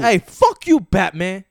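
high, loud rapper impression; six nine. Topping friend's impression, high loud rapper voice. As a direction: yell it in a high New York rapper voice. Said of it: "six nine voice".

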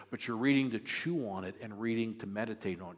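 normal talking voice

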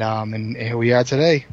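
ordinary delivery

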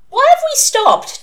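put-on very high, loud child's complaint; demanding. A narrator's reading. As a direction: demand it indignantly in a small girl's voice. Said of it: "demanded the suspect".

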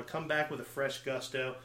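normal talking voice

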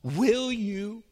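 impassioned spoken prayer; fervent prayer. Acted. Prays aloud at full force.

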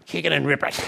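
lightly voiced daredevil shouting his motto; Captain Risky. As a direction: punch it like a thrill-seeker's war cry. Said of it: "For Captain Risky".

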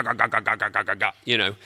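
low, soft "ga, ga, ga" grumble; mock grumbling. Pastor mocking the congregation's complaints, low grumble. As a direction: mutter like a complainer. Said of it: "They're grumbling".

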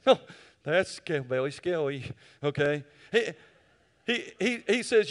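ordinary talk

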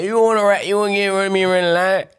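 high, loud Florida accent impression. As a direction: put on a thick Florida accent. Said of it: "I hate using my Florida accent".

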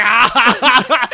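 high, loud cackling laugh; laughing cry. Retold or imitated. Friend reliving the cathartic ending, cackling laugh. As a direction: let out a delighted laughing cry. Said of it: "I was like".

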